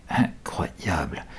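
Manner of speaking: slow unvoiced whisper; murmured. A narrator's reading. Mick murmurs at the sudden fog: awed whisper. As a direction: breathe it in awe. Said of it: "murmure Mick".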